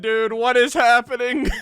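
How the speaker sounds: usual speaking voice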